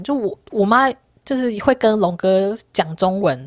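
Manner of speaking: ordinary talk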